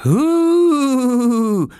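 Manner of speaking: high, drawn-out "hooo"; ghostly hoot. Acted. Oskar haunting little Lars's cot, high ghostly hoot. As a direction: hoot like a spook in a high, loud voice. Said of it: "machte Oskar lauter und mit einer noch tieferen Stimme".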